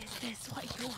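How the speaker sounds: very soft, hushed murmur; mock ASMR. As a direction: play it close and quiet to the mic. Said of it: "It's A S M R".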